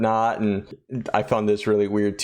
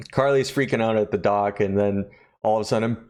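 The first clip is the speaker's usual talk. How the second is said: ordinary delivery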